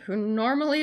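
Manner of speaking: normal talking voice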